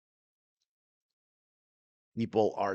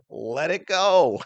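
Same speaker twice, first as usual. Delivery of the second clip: mocking; 7 semitones higher, 5 dB louder, much slower than usual. Mocking Karen's words back.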